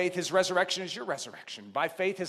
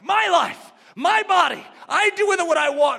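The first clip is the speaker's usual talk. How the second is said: high, emphatic voice of selfishness; mock self-willed; 9 semitones higher, 8 dB louder than usual. Preacher voicing the self-enthroned old life, mock self-willed. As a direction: insist on each claim like a willful egotist.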